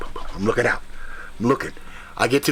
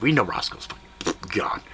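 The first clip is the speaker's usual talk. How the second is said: softly whispered aside; 8 semitones lower, 6 dB quieter than usual. Retold or imitated. Hunter telling listeners the first dog is dead, whispered. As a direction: breathe it so nobody hears.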